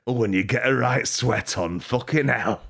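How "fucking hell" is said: ordinary talk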